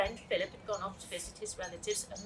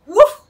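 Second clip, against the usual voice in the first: loud startled dog bark, high and sharp; one sudden loud woof; alarmed bark; 12 semitones higher, 24 dB louder than usual. Acted. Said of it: "he barked in alarm".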